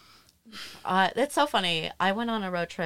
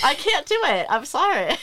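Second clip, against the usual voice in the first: higher, louder, laughing through it; admitting she can't navigate, laughing; laughing apology; 9 semitones higher, 7 dB louder than usual. Real, not acted. Laughing self-deprecation.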